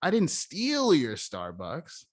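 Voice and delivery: "steal" stretched long and pitched high; slow line, big high emphasis on "steal"; stress on STEAL. Shown as a demonstration. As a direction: punch and drag out "steal", hinting at some other deed. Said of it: "Now, let's emphasize this".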